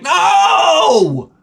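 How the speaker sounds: high, loud frustrated no; exasperated shout. Retold or imitated. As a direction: yell it in despair. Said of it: "You're like".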